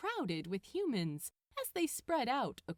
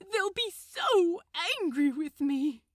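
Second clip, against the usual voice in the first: loud desperate pleading angel; loud protest; 7 semitones higher, 8 dB louder than usual. Acted. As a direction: protest in panic, near shouting. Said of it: "he protested".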